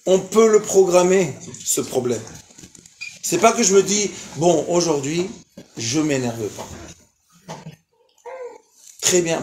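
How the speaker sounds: usual speaking voice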